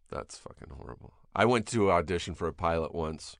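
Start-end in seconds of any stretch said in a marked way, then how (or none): 0.1-1.1 low whispered dread; whispered aside. Retold or imitated. Actor facing a silent casting room, whispering. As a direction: whisper it to yourself.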